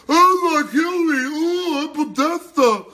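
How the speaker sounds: high, loud, slow prissy parody; mock elitist voice. Host mocking how insiders talk, high, loud and campy. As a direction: camp it up like a smug insider. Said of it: "as long as he talks like this".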